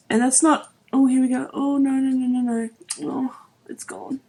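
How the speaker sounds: real choked-up alarm fading to a whisper; tearful dismay. Real, not acted. Tear escapes while she speaks.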